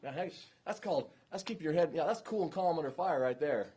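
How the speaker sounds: high, loud admiring gush; impressed viewer. Retold or imitated. Viewer reacting to the calm trooper video, impressed and excited. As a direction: gush over how cool he stays. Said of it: "the reaction I get is".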